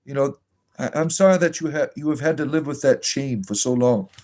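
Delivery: syrupy, sing-song reassurance, hammed-up; mock pastoral comfort. A mock pastor comforting Robert, syrupy and over-sweet. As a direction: lay the fake sympathy on thick like a gushing pastor.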